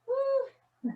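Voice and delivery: high loud whoop of effort. Real, not acted. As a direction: let out a straining woo. Abs burning during flutter kicks.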